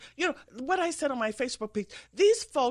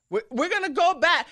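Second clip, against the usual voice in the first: louder mock alarm, slightly higher; mock Hillary warning; 6 semitones higher, 6 dB louder than usual. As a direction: parody her dire warning. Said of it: "Hillary Clinton said".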